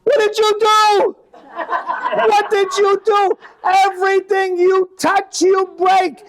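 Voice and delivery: high shrieking Italian dad, slow and loud; father blaming son for the blackout, shrieking high; furious father. Retold or imitated. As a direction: scream the blame at the kid, way up high. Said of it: "he goes".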